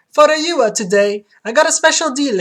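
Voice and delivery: high, loud Italian barber; Italian accent. Acted. Barber welcoming a customer, Italian accent. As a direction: play an exuberant Italian barber. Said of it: "platinum blonde with Italian accent".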